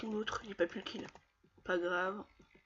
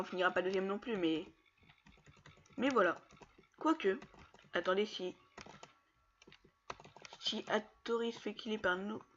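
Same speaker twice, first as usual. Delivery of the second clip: ordinary delivery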